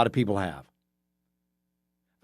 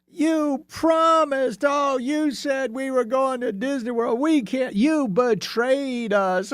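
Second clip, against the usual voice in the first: put-on much higher, slow whining kid voice; whiny five-year-old; 12 semitones higher, slower than usual. Kids whining that dad broke the Disney promise, high slow whine. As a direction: drag it out like a betrayed little kid. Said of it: "that five-year-old mentality".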